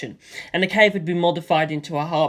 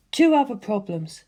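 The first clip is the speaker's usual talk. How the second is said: a raised, cool and thoughtful woman's voice; Lisa Lisa's voice; 4 semitones higher than usual. A narrator's reading. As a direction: voice the calm Hamon master, puzzling it out.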